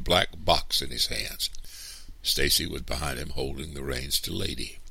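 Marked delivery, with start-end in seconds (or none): none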